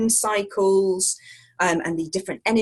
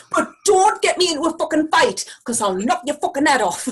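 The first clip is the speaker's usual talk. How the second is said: mock-threatening Geordie, raised at the start; Geordie accent; 6 semitones higher, 3 dB louder than usual. Guest clowning as a sweary local, mock-aggressive Geordie accent. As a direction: play at picking a fight in Geordie. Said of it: "say it in a Geordie accent".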